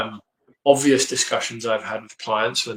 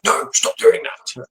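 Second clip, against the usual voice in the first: slow, harsh mock telling-off; mock scolding; slower than usual. Frustrated owner to a dog at the fence, mock scolding. Snapping at dog over leash.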